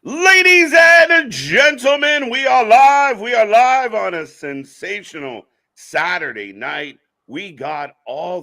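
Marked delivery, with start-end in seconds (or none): 0.0-3.2 huge, slow booming announcer call; ring announcer shout. Acted. Show opening announcer call. 3.2-7.0 drawn-out hype announcer read. Acted. Show opening announcer call.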